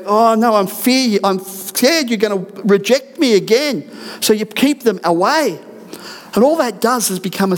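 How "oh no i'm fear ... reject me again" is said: higher, anxious imitation of a fearful person; a hammed-up fearful voice, pitched higher; mock frightened plea. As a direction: play the scared one pushing love away, lightly. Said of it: "and you go".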